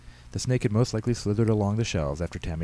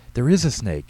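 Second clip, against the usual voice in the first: put-on loud, higher boy's protest; crying out; 5 semitones higher, 7 dB louder than usual. A narrator's reading. Boy contradicting his disbelieving mother, crying out. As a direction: insist loudly like a small child. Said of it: "Tyler cried".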